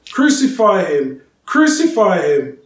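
the mob demanding a crucifixion; shouting crowd. A narrator's reading. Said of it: "began shouting".

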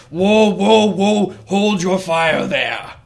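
big gruff creature calling out, loud and high; gruff minotaur voice. Acted. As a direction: boom out a drawn-out halt like a burly beast. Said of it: "a gruff, deep voice says".

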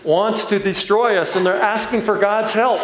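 words bubbling up through laughter; real laughter as the story lands; laughing. Real, not acted. Laughing at the memory.

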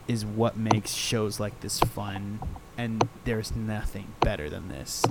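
ordinary talk, nothing marked

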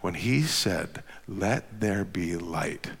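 low, soft voice with long pauses; slow down and sink toward a whisper; hushed reflection. Real, not acted. Preacher leading congregation with eyes closed, hushed reflection.

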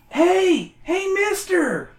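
high, calling out to the owner; yelling. Acted. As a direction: shout for the store owner. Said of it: "you yell to the store owner".

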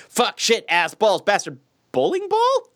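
mock Tourette outburst. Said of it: "randomly start twitching and cursing".

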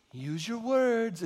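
slow, soft mom voice; mom's gentle coaching. Retold or imitated. Mother coaching a screaming child, slow and soft. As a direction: coax the toddler slowly. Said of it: "Your mom was telling you".

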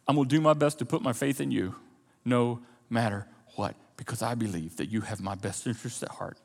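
low, measured prayer with long pauses; trusting prayer. Shown as a demonstration. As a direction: pray quietly and earnestly, in submission. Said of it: "Prayer goes like this".

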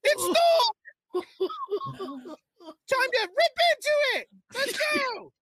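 high squeaky cartoon voice, drawn out slowly; gingerbread man voice. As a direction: stretch it out as the tiny cookie man. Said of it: "You gotta stay in character".

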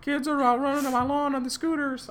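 put-on high-pitched cranky old man voice; high squeaky Slapperman voice. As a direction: squeak out the complaint. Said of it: "He's like".

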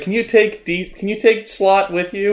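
high-pitched hopeful begging; mock pleading. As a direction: beg a favour in a high, comic plea. Acted plea to departing writer.

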